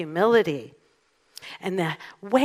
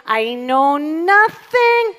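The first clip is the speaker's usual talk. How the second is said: loud, high, drawn-out German sergeant; German accent; 7 semitones higher, 8 dB louder, much slower than usual. Acted. Imitating a sitcom sergeant who overlooks much, German accent. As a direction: proclaim it slowly in a comic German accent. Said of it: "so that's my German accent".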